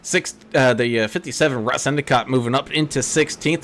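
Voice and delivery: normal talking voice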